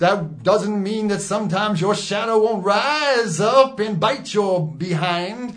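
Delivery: high preacher voice; preaching. Acted. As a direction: preach it to your flock. Mock preacher voice.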